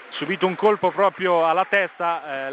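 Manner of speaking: normal talking voice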